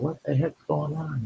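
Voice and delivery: high, soft puzzled wondering; what on earth is this; puzzled thought. Retold or imitated. Puzzled boy hears girl crying.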